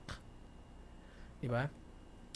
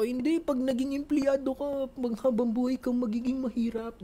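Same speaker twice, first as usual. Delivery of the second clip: slower, higher heated retort; mock arguing; 8 semitones higher than usual. Arguing with parents about jobs, mock heated. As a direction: argue back at the parents. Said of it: "Nakikipagtalo ko".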